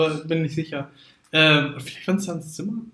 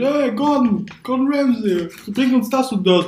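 mock shout; 7 semitones higher than usual. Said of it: "mit vollem Mund schreien wir".

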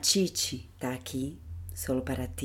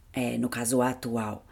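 normal talking voice

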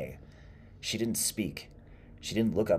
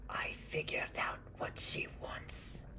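slow, raspy whisper; near whisper; 4 dB quieter than usual. Acted. Old woman confiding in the woodcutter, nearly whispering. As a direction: whisper it slowly, like a fearful secret. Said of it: "she nearly whispered".

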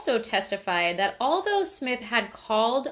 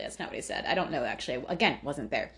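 usual speaking voice

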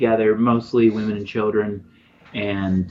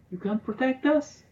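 put-on very high, softer child voice; little girl; 5 dB quieter than usual. Little girl asking travellers for protection, high small voice. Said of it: "says a little girl".

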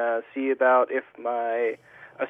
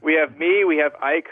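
usual speaking voice

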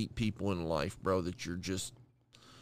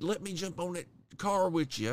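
put-on country accent; 6 semitones higher than usual. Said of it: "he was country as fuck".

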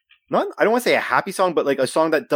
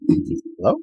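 through a wall; 4 semitones higher than usual. Real, not acted. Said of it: "you sound so far away".

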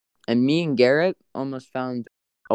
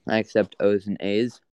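normal talking voice

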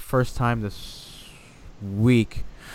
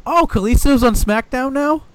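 put-on clueless young fan; 12 semitones higher, 5 dB louder than usual. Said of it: "young fans are like".

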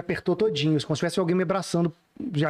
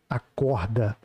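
low, drawn-out mysterious call; lightly voiced voice from inside the head; imitated woman's voice; 8 semitones lower, much slower than usual. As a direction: drop low for a one-word command. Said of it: "era uma voz de mulher".

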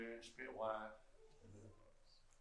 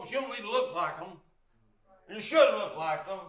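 higher and louder, driving the sermon; full-force preaching; 6 semitones higher, 9 dB louder than usual. Acted. Preacher pressing the congregation to look right. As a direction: preach it hard and loud.

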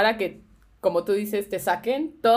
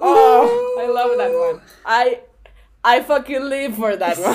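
excited shout; 3 semitones higher, 7 dB louder, slower than usual. Real, not acted. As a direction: burst out with delight. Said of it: "delighted outburst". (emoción desbordada)

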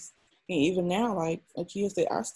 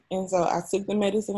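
normal talking voice

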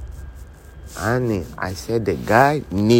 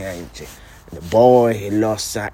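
usual speaking voice

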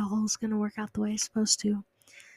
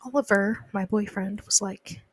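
ordinary talk

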